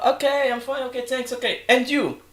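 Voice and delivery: sample conversational reply. Shown as a demonstration. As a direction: demonstrate a chatty reply that bounces back. (resposta encenada, em tom mais agudo)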